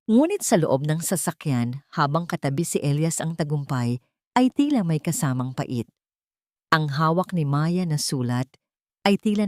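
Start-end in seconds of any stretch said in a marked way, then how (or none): none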